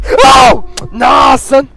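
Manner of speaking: high startled yell; startled shout. Real, not acted. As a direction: jump out of your skin. Jump scare in horror game.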